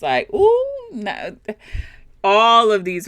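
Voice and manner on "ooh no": mock shocked gasp. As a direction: act out the shock of spotting a mistake. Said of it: "You're just reacting".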